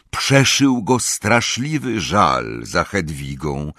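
ordinary delivery, nothing marked

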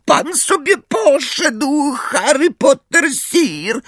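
high, slow creature voice; cackling elf. A narrator's reading. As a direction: gloat slowly in a high elf voice. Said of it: "Skrzat zachichotał ochryple". (wysoki, powolny głos chichoczącego skrzata)

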